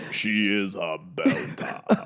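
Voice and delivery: slow, low robot voice; lightly voiced giant robot. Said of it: "And the whole robot goes".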